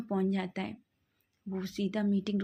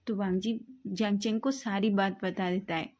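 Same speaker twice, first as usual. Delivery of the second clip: ordinary delivery, nothing marked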